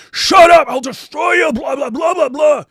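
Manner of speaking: high, very loud mocking shout; hammed-up screamed threat dissolving into blah-blah; mock tirade. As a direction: send up his rage in a high-pitched yell. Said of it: "He'll, he'll, he'll, he'll do".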